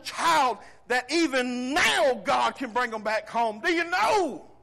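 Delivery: loud, high, impassioned preaching; full-throated revival preaching; preaching at full force. Acted. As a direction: preach it out, voice climbing.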